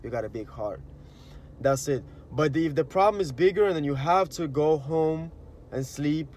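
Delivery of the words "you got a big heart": much softer, lower grateful reply; touched reply. Retold or imitated. The forgiven person thanking the one who apologized, soft and low. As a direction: answer quietly, moved. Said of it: "And it's like".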